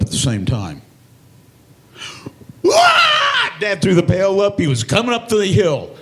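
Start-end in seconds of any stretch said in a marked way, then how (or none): 2.6-3.5 high, loud yelp of fright; a sudden scared shriek; startled yell. Retold or imitated. Dad surprised by the bear, a high loud scared yelp.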